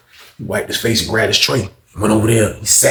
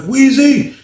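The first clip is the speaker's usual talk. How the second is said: high-pitched, raised-voice name call; 6 dB louder than usual. Retold or imitated. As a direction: holler for someone down the hall. Imitates the repeated call.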